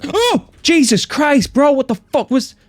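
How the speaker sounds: high gasping shriek of fright; terrified gasp. Real, not acted. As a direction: jump out of your skin. Jump scare in horror game.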